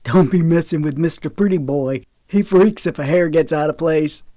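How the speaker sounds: drawled teasing jeer; hooted, mocking character voice; hooting mockery. A narrator's reading. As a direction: rib your brother in front of everyone. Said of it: "Ramsey hooted".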